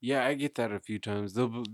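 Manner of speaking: ordinary talk, nothing marked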